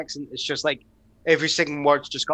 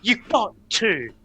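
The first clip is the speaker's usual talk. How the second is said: Shatner impression; 4 semitones higher, slower than usual. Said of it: "a Shatner performance".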